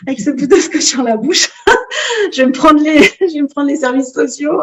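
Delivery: laughing through the words, voice pushed up. Real, not acted. Mother laughing while telling the interviewer the story. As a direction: giggle it out as you tell the joke.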